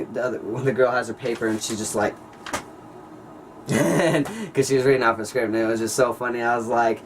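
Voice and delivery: ordinary delivery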